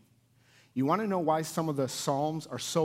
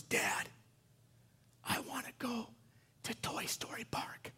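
put-on very soft, breathy little-boy whisper; imitated child whisper; 9 semitones higher, 11 dB quieter than usual. Little boy telling Dad his wish at bedtime, whispering. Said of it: "I just whisper things in his ear".

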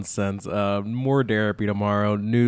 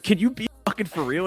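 higher mock-exasperated voice; 9 semitones higher than usual. Friend fed up with the accent, mock exasperation. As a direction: plead in a raised pitch, acted. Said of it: "they're gonna be like".

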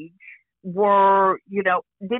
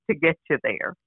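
ordinary talk

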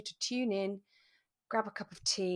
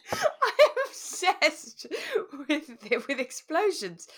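much higher and louder, breaking into laughter; excited laughing correction; 11 semitones higher, 8 dB louder than usual. Real, not acted. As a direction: catch yourself mid-giggle. Correcting own joke, giggling.

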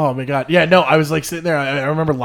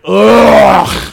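loud, drawn-out "ugh" of disgust; high, loud revolted groan; 6 semitones higher, 11 dB louder than usual. Retold or imitated. Disgust at actor's accent.

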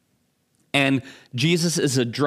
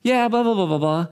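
careless reply; 8 semitones higher, faster than usual. Retold or imitated. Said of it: "doesn't read it out of anger".

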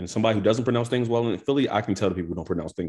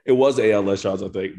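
ordinary talk

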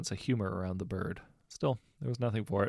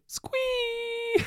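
very high gleeful squeal; squee of delight; 5 dB louder than usual. A narrator's reading. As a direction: cut him off with pure glee. Girl interrupts again with delight.

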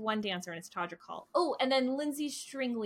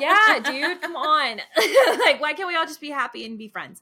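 laughing loud, pitch up; 5 semitones higher, 12 dB louder than usual. Real, not acted. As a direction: laugh through the plea. Laughing exasperated plea for peace.

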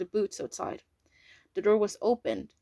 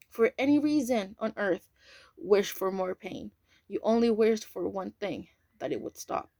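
ordinary delivery

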